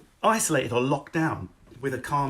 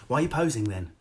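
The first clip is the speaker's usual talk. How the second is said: ordinary talk, nothing marked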